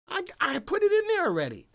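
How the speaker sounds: very high, loud defensive squeal; hammed-up exasperation, pitch shooting up; mock indignant protest. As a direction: protest at the top of your voice. Protesting Janice's mock berating.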